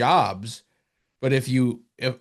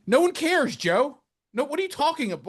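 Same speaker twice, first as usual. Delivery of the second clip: exasperated rant; 13 semitones higher than usual. Real, not acted. Ranting at announcer's trivia.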